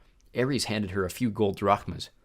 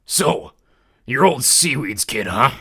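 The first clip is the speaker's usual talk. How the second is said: louder, slow taunting god of war; wicked grinning biker; 2 semitones higher, 7 dB louder, slower than usual. A narrator's reading. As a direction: sneer it out like a bully. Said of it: "He gave me a wicked grin".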